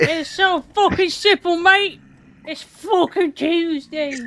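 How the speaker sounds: put-on British accent. Copying British slang voice.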